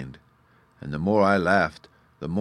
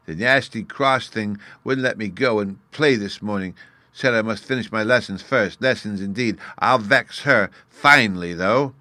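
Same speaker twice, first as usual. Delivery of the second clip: muttered; 4 semitones higher, 6 dB louder than usual. A narrator's reading. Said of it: "he muttered to himself".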